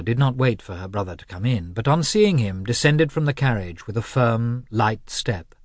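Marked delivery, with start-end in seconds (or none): none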